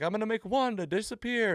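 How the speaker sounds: high, hammed-up magician announcing a trick; imitating a showman; mock showman voice. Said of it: "he's like".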